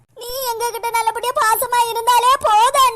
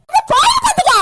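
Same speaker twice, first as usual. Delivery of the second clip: higher, louder yell; shouting; 6 semitones higher, 4 dB louder than usual. Acted. Elder sister shouting at her scheming sister. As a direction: hurl the warning at her.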